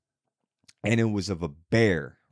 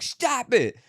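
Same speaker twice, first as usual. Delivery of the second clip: mock scold; 12 semitones higher than usual. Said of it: "She's like".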